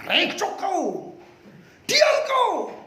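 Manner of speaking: high, slow Sumatran-style hushing that ends in a shout; Sumatran scolding. Acted. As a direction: shut him up, Sumatran style. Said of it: "Kalau dia orang Sumatera".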